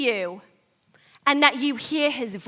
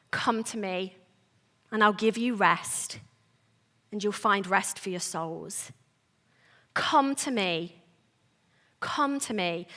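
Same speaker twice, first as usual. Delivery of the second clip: tender invitation; 7 semitones lower, 8 dB quieter than usual. Retold or imitated. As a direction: lower and much softer, gently inviting. Jesus inviting the weary to rest.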